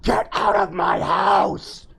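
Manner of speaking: slow, drawn-out creepy voice; loud ghostly warning, slow. Acted. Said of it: "he made a creepy voice".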